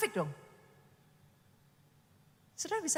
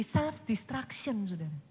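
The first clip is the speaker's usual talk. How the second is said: usual speaking voice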